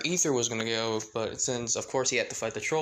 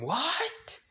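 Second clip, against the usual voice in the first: shocked squeak; 12 semitones higher than usual. Acted. Paimon shocked again.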